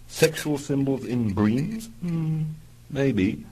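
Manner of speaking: slow, rising intrigued question; linger, curious. Acted.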